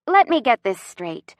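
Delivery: ordinary delivery, nothing marked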